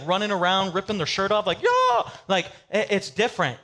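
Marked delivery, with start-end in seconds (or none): 1.6-2.1 very high, loud cheer; a sports fan going crazy in the stands; hype yell. Retold or imitated. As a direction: scream it like a shirtless fan. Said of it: "The hype person would be".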